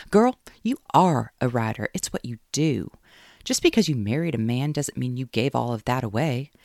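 loud rallying pep talk; cheerleader tone. Acted. Caroline pumping up Kate on the phone like a cheerleader. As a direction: fire her up like a cheerleader. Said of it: "She took the tone of a cheerleader".

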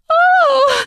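high loud cry of pleasure; gasp, then cry out high. Acted. Woman screeches out pleasure.

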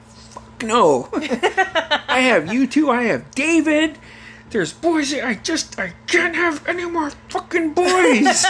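high, drawn-out frantic refusal; imitated exasperated mother. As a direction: refuse as a mom at wit's end. Mother refuses more boys.

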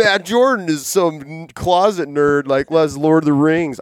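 lightly voiced imagined listener teasing the host; mock listener. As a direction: mimic a listener ribbing a closet nerd. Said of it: "people are gonna listen to this podcast and go".

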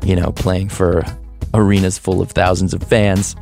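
normal talking voice

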